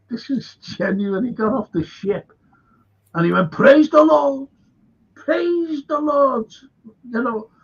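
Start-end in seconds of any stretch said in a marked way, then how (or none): 3.5-6.5 high, loud hammed-up cry of praise; mock joyful praising. Bradford stepping off the ship, hammed-up ecstatic praise. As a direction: throw your arms up and praise the Lord, a touch overdone.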